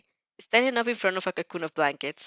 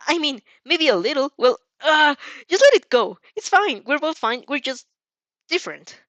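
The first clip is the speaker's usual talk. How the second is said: high, loud, hasty self-correction; acted fluster, loud and high; flustered backtracking; 7 semitones higher, 7 dB louder than usual. A narrator's reading. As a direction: blurt it out, pitched well up.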